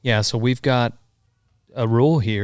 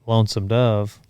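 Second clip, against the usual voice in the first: normal talking voice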